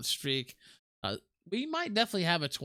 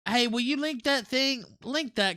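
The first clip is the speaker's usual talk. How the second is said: urgent call; 10 semitones higher, 5 dB louder than usual. Real, not acted. Urgent request to co-host.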